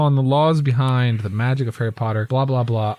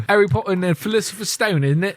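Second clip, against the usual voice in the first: pitched up a bit, a light put-on British accent; mock British accent; 7 semitones higher than usual. Joking about how British people say the book title, light mock British accent. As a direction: toss it off like a Brit correcting the title. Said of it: "That's how British people would say it".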